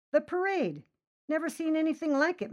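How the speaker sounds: high, fast and thrilled; giddy rave. Acted. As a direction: gush with wide-eyed wonder. Stoned man raving to officer.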